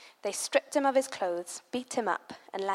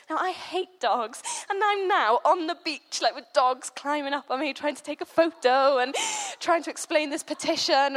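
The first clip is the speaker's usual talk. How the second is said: exasperated; 5 semitones higher, 5 dB louder than usual. Real, not acted. Dogs climbing over her, laughing.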